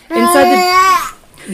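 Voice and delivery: slow dramatic high read; intense. Acted. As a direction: overdramatic suspense read. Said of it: "yeah, intense".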